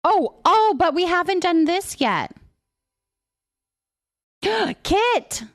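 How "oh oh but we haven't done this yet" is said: usual speaking voice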